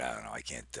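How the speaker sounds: ordinary talk, nothing marked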